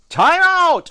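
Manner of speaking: high, loud protesting yell. A narrator's reading. Boy halting play as umpires laugh, yelling. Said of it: "I yelled".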